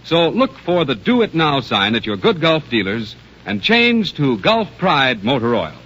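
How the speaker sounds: ordinary talk, nothing marked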